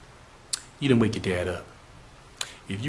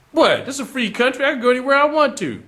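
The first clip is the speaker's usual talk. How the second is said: put-on higher, defiant young man scoffing; defiant retort; 10 semitones higher, faster than usual. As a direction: toss back a cocky challenge. Darnell defies the woman.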